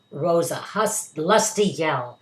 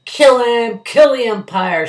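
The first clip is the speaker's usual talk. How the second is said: high, loud crowd yell; angry shout; 8 semitones higher, 11 dB louder, slower than usual. A narrator's reading. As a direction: yell it from the stands. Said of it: "shouted someone on the stand".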